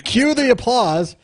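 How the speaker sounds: laughing cue to the crowd; mock showman. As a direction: announce it like a game show host. Mock showman after timing gag.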